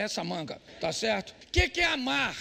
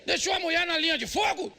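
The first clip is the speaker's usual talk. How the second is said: mock shout; 7 semitones higher, 3 dB louder than usual. Retells husband fleeing robbery.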